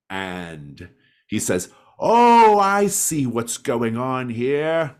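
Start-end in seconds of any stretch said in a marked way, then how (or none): 2.0-5.0 loud, high, drawn-out indignant cry; angry accusation. Acted. Old man catching out supposed thieves, loud angry accusation. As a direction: pounce on it, loud and outraged.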